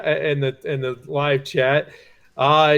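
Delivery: ordinary delivery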